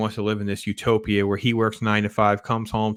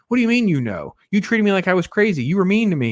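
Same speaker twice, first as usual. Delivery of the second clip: higher, indignant retelling of Alice's protest; mock hurt outrage, pitched up; imitating an upset wife, pitched up; upset accusing Alice; 8 semitones higher, 3 dB louder, faster than usual. Alice confronting Bunny, upset.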